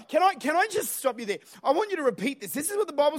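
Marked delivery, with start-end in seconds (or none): none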